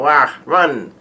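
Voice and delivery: loud two-burst warning; mock panicked yell. Jinbe warning the crew to flee, mock panicked yell. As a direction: shout a warning, half in play. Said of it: "[name] yells out".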